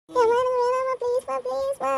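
put-on high child begging mom; whining plea. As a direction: plead tearfully not to be held down. Child pleads before injection.

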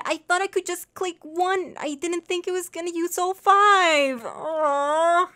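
high, loud upset cry over the lost tags; high whiny protest at a game mistake; dismayed wail. Real, not acted. Name tags all used up, dismay.

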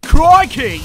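loud, high Australian exclamation; startled outburst. Acted. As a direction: blurt it out in Australian shock. Startled by the bone eating.

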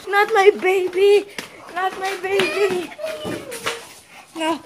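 high, anguished protest; distressed cry. Acted. Clown threatening the baby, distressed. Clown takes loved one.

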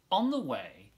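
plain reading voice

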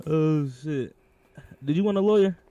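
usual speaking voice

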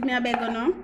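usual speaking voice

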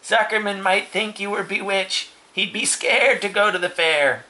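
high-pitched character voice; story character's warning. Acted. Warning a pig about looking bewitched, high character voice.

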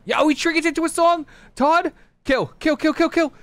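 high excited shouts at a teammate; excited yelling. Real, not acted. Gamer yelling at teammate Todd to kill. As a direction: yell kill calls in a frenzy.

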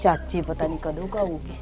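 very soft, low, near-whispered murmur; muttering to self. Acted. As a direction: think aloud under the breath. Talks to herself alone.

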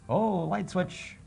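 high, slow gasp of discovery; mock surprise. Host feigning surprise at the light switch, hammy and high. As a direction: overplay finding the switch.

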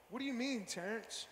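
soft, low, dodging mumble; hushed evasive denial. Retold or imitated. Young [name] to friend Terrence, quietly dodging the question. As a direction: play dumb under your breath. His own evasive reply.

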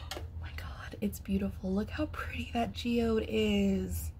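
hushed gasping whisper, overcome; gasp, then whisper in tearful wonder; awed, about to cry. Real, not acted. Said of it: "I'm about to cry".

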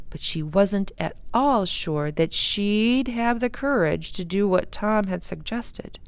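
plain reading voice

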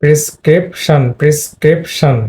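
prescription in separated syllables; correct prescription. Shown as a demonstration. Teacher modelling prescription's second-syllable stress, split into syllables. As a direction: sound out pri-scrip-shan with weight on scrip. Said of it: "এখানে দ্বিতীয় সিলেবলে stress পড়েছে".

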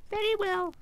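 very high little royal squeak; squeaky mouse queen. A narrator's reading. Mouse queen explaining her rescue, squeaky. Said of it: "in a squeaky little voice".

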